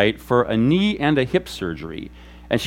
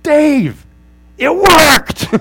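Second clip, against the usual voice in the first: high, loud joyful outburst, much higher and louder; excited shout; 12 semitones higher, 8 dB louder, much slower than usual. Retold or imitated. Friend telling the pastor a healing prayer worked, excited high shout. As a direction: cry out in amazed delight. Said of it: "friend looks at me and goes".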